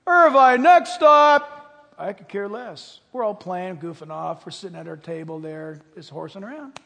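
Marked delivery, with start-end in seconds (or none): 0.1-1.9 loud, higher conductor call; train conductor. Retold or imitated. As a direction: call the stops down the aisle. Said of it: "train pulls away".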